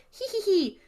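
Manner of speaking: high-pitched mock ritual noise; high, silly little "hee hee hee" imitation; mock chanting. Podcaster imitates John chanting at his ritual, high mocking squeal. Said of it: "John is chanting in Latin".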